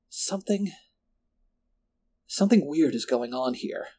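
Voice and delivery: falter, then voice the worry; uneasy. A narrator's reading. Lindsay confides a sense of wrongness to her friend, uneasily.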